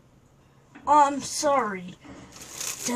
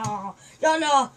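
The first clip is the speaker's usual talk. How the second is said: ordinary delivery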